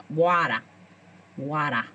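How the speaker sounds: low New York WATER with open vowel; New York accent. Acted. Practising a Long Island friend's accent, low New York. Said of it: "so she knows the accent".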